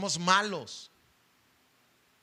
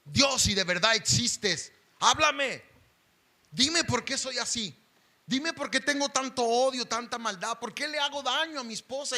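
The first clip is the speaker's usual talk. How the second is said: higher, louder desperate plea; crying out to God; 7 semitones higher, 5 dB louder than usual. Retold or imitated. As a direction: cry out to heaven, begging. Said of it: "le gritaba con todas mis fuerzas a Dios". (clamor desesperado a Dios)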